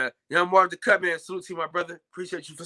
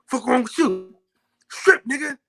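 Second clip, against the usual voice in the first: high-pitched, angry quoted demands; mock-angry movie quote, high and punchy; mad movie character; 8 semitones higher than usual. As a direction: imitate a furious man barking orders, pitched up.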